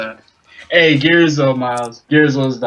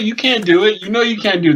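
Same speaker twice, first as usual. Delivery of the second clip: retold taunt; 6 semitones higher than usual. Voicing a taunt at online threats.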